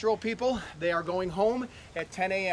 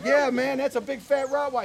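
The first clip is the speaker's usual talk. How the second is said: higher and louder, selling the lie with a grin; cheeky bluff; 7 semitones higher, 6 dB louder than usual. Retold or imitated. Owner tells the driver his bear is a dog, cheeky and loud. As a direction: brazen fake innocence.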